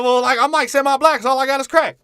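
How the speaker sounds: very high, loud yelled squeal; caricatured character voice. Acted. Comic voicing a movie character to his friend, high yelled caricature. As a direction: shout it way up high as a hammed-up character.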